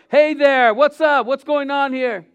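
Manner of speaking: high, loud breezy greeting; mock casual greeting. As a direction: play it like a pal barging in.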